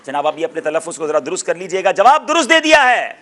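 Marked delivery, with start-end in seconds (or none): 1.9-3.1 high, loud host's cry; big game-show proclamation; triumphant announcement. Real, not acted. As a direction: declare the right answer to applause. Quiz host announcing correct answer.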